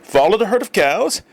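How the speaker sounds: high amused disbelief; captain's surprise. A narrator's reading. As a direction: toss it back as an incredulous question.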